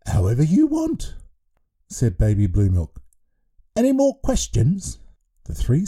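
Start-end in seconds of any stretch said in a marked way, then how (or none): 0.1-1.1 put-on raised, childlike voice of a giant baby headmaster; a cheerful baby in charge of a school. 3.7-5.0 put-on raised, childlike voice of a giant baby headmaster; a cheerful baby in charge of a school.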